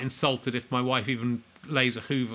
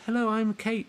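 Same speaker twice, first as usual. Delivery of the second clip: put-on lightly raised girly voice; a hint of a put-on girl; girl's voice; 8 semitones higher than usual. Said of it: "I can put on a girl's voice".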